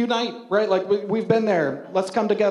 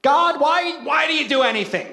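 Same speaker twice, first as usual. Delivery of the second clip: exasperated sigh to God; 7 semitones higher than usual. Retold or imitated. Pastor acting out frustrated prayer over a paper cut, high exasperated plea. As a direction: throw the question up to heaven in exaggerated exasperation. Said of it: "audible sigh of".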